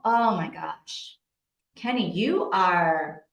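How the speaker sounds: normal talking voice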